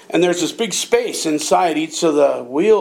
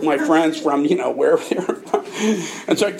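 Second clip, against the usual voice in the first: ordinary delivery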